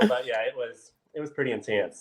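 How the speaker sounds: ordinary delivery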